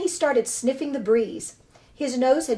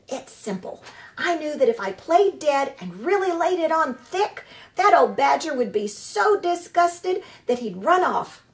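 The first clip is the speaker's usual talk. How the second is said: high, showy actress voice; proud theatrical opossum; 4 semitones higher, 4 dB louder than usual. Acted. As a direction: boast like a grand stage diva.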